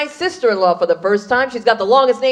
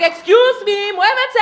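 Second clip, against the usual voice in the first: sassy Puerto Rican woman; 9 semitones higher, slower than usual. Pretend. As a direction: clear your path with high-pitched sass. Pushing through as Puerto Rican woman.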